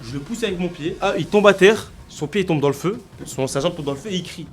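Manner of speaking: usual speaking voice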